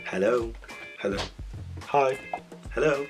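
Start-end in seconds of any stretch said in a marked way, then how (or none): none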